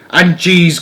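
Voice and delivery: mild witch cry. Acted. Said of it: "cried the Grand High Witch".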